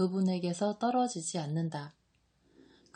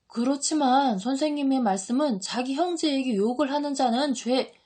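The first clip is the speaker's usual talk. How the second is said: high, loud objection; indignant protest; 6 semitones higher, 6 dB louder than usual. A narrator's reading. Judas protests to Jesus about an insult, indignant. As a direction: argue hotly until cut off.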